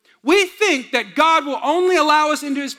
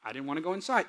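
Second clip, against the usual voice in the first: put-on five-year-old son; 5 semitones lower, 11 dB quieter, faster than usual. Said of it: "he goes".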